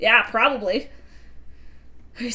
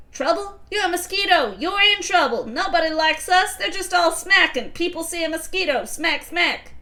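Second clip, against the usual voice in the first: higher, cocky mosquito rant; 8 semitones higher, 3 dB louder than usual. Acted. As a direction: play a jaded mosquito griping about life. Voicing the mosquito figure.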